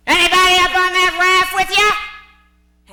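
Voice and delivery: gruff, loud slaver demanding; loud rough-voiced interrogator; slaver's voice. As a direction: bark it like a hostile slave hunter. Slavers questioning Huck.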